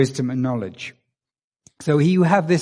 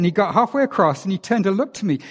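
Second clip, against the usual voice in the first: normal talking voice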